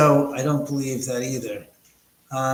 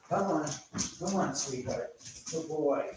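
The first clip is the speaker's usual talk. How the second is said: coaxing the dog; 8 dB quieter than usual. Real, not acted.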